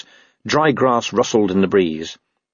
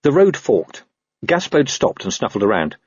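ordinary delivery

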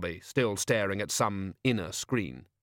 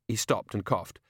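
ordinary delivery

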